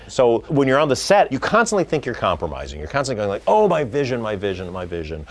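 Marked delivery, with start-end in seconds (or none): 3.5-5.3 mock fretting. Mocking director fretting over compromise.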